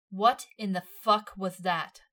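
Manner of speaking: loud, slow, furious demand; demanding shout. A narrator's reading. Levi yelling at Himmel after her crash, loud demand. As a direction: bark it at her, slow and hard. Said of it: "Levi demanded".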